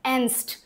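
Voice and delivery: एंस्ट said "enst", no g; silent g. Shown as a demonstration. Teacher shows German एंस्ट without the g. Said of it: "जी नहीं बोला जाता".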